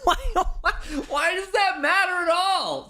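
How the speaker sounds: laughing shout of disbelief; cracking up while yelling it. Real, not acted. Laughing disbelief at debate clip.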